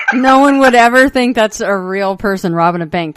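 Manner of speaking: laughing loudly while talking; words spilling out through laughter. Real, not acted. Laughing at terrifying mask.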